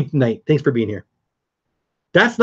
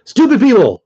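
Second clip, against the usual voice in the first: higher and louder, an exasperated yell; exasperated shout; 11 semitones higher, 10 dB louder than usual. Real, not acted. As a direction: yell it while kicking the trolls out. Banning trolls from the live chat.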